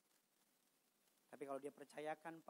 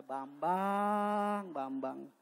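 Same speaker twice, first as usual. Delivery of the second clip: exasperated inner sigh; 15 dB louder, much slower than usual. Retold or imitated. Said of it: "dalam hati saya".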